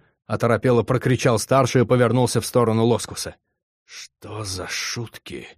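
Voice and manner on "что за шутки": stunned slow whisper. A narrator's reading. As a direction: breathe it out in shock. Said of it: "прошептал он".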